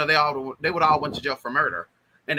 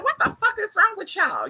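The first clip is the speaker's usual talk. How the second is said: very high, indignant auntie voice; cussing auntie. Retold or imitated. Aunt waking up among fretting family, mock cussing auntie. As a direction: imitate a cussing aunt, pitched way up with a laugh. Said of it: "she was a cussing auntie".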